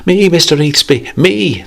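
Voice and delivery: put-on children crying out for coins; excited children. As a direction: squeal like eager kids. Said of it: "they cried".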